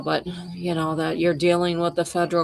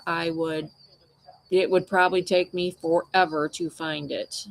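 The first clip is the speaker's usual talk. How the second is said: ordinary talk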